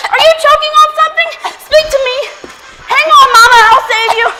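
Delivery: loud, high, urgent rescue cries; panicked shouting. Acted. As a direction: yell in alarm to a choking mother. Rushes to save choking mother.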